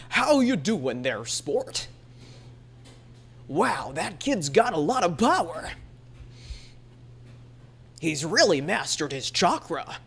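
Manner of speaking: put-on slow rolled old-man voice; rounded old man voice. Shown as a demonstration. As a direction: roll every word in the throat. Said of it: "Nobody talks like that".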